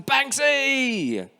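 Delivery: high, ringing shout of a name; excited call. Acted. Boy shouting to Gordon Banks, high and thrilled. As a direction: call out to your hero on the pitch. Boy calling to his hero.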